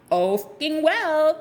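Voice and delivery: high, drawn-out shrug-off; loftily brushing them off; sassy dismissal. Acted. Acted dismissal of people who disapprove.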